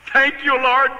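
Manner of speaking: high-pitched, exuberant praise; giddy retold hallelujah moment; jubilant thanks. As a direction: re-enact an overjoyed thank-you prayer. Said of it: "a minister acting like that".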